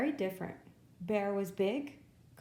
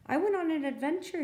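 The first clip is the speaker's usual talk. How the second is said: put-on shivering Baby Deer; 7 semitones higher, 3 dB louder, faster than usual. A narrator's reading.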